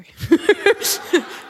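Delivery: a loud, high real laugh at herself; high burst of laughter. Real, not acted. Laughing at her own gym joke to the congregation. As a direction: crack up at your own joke.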